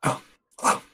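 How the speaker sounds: hushed, barely voiced greeting; whispering. Real, not acted. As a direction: keep it down for sleeping roommates. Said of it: "You sound like you're whispering".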